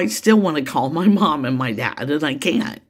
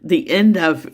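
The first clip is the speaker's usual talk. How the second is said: teary. Real, not acted. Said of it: "I apologize for getting teary here".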